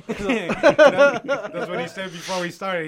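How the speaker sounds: laughing through the words; slow, laughing delivery. Real, not acted. Laughing while telling it.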